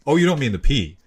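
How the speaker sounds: ordinary delivery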